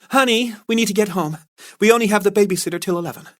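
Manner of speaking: high, quick wifely voice; Carla as wife. Acted. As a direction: hurry him home like a pretend wife. Carla rescues Ryan.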